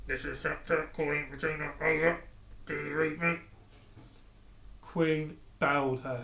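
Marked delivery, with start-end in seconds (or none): 0.1-3.4 muffled radio voice. A narrator's reading. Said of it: "A muffled voice on the other end said".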